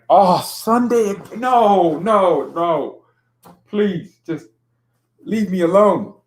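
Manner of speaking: high, drawn-out wail of protest; dismayed protest. Real, not acted. Fan learning his team plays on Sunday, dismayed protest. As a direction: plead in loud, high dismay.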